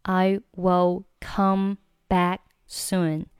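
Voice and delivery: "I will come back soon" very slow, each word separate; slow word-by-word demo. Shown as a demonstration. Teacher separates words, then reads connected. (逐词慢读示范)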